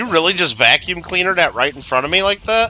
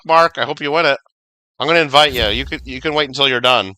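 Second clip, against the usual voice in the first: usual speaking voice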